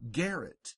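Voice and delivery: garret with G as in gate; hard G. Shown as a demonstration. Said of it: "G is also always hard".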